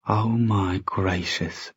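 soft, slow, drawn-out exclamation; hushed dismay. A narrator's reading. Sophie reacting to the shocking letter, hushed and slow. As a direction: let it out slowly, barely aloud.

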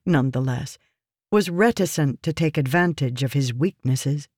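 ordinary delivery

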